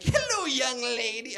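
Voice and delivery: slow, high leering hello; hammed-up old man greeting; creepy old man greeting. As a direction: drag out the hello like a lecher. Retirement home residents' greeting.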